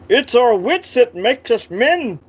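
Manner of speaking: hammed-up Scottish accent movie quote; Braveheart accent. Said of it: "a southern redneck from Alabama trying to do Braveheart, accent".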